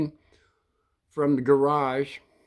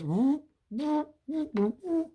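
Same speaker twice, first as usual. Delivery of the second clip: quick high whoops for clipper strokes; high-pitched "whoop" sound effect; imitated shears noise; 13 semitones higher, 3 dB quieter, slower than usual. Imitating grandpa's shears at work.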